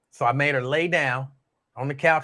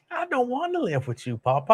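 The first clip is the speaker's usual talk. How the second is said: put-on higher little-girl voice, unhappy; imitating a four-year-old girl; 10 semitones higher than usual. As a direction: whine it like a child who won't go.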